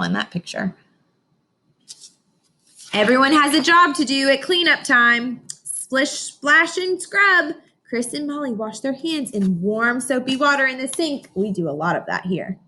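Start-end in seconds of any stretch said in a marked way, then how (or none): 2.9-5.4 slow, bright storybook read-aloud; reading to children. Acted. Teacher reading a picture book to her class, slow storybook voice. 5.5-7.5 each word climbing higher and louder; playful build. Acted. Teacher making cleanup sounds fun for kids, rising playful. 7.9-11.3 slow, bright storybook read-aloud; reading to children. Acted. Teacher reading a picture book to her class, slow storybook voice.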